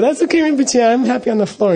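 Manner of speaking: high-pitched, placating retold protest; polite refusal. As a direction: imitate a junior monk wriggling out of an order. Monk nervously declines lama's couch.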